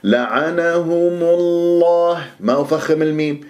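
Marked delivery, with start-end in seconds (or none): 0.0-2.2 لعنهم الله slow, lam of الله thick and full; heavy lam in Allah. Shown as a demonstration. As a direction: round the lips and darken the lam of الله. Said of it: "تفخم اللام من اسم الجلال".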